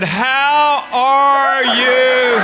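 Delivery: high, very slow, drawn-out greeting. Retold or imitated. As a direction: stretch each word like the old act. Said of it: "I said".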